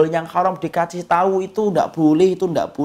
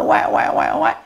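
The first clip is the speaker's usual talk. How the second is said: imitated baby cry. Imitating newborn crying.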